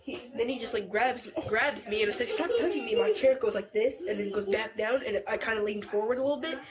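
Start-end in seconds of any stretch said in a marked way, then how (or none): 2.3-2.9 higher-pitched irritated protest; retold annoyed demand. Youth retelling being grabbed in the chair, annoyed. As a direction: replay snapping at being grabbed, without shouting.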